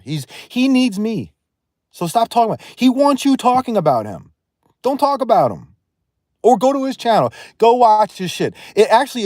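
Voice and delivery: high, loud, exasperated rant at chat; angry rant. Real, not acted. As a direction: shout them down, fed up and furious. Streamer rants at chat about rival.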